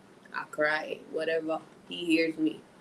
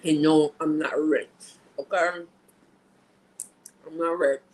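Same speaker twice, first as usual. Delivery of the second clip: louder, firm denial; 5 dB louder than usual. Real, not acted. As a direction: shut the idea down firmly.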